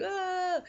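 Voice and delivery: high-pitched mock choking cry; mock strangled cry. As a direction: cry out as if stepped on. Mimics being choked on ground.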